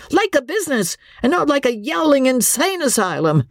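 furious captain yelling at his wife; exasperated shout. Acted. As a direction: snap at her, patience gone. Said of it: "Andy's patience ... snapped".

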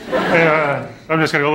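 normal talking voice

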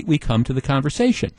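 normal talking voice

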